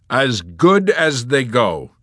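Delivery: loud, high, drawn-out praise; emphatic assurance. A narrator's reading. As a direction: stretch out each word with conviction. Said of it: "Yossarian assured him".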